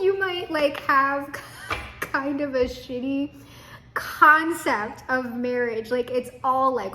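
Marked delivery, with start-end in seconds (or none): none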